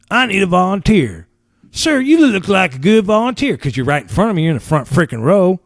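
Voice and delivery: high, showy stage host calling on him; mock emcee voice. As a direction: ham up the announcer picking a volunteer.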